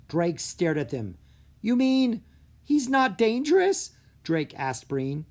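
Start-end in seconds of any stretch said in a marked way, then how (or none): 1.6-3.9 loud, high astonished question; disbelief. A narrator's reading. Drake realising the ogre is harmless, astonished. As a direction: ask it in disbelief.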